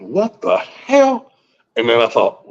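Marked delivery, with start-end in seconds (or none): 0.0-1.3 very slow, high disbelief; stunned what the hell. Retold or imitated. Caller retelling six G popping up again, stunned. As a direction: stretch it out in shock. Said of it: "I went".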